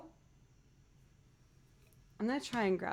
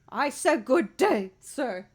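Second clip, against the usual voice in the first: mock outraged shout; 2 semitones higher, 5 dB louder, slower than usual. Repeating the huffy movie line.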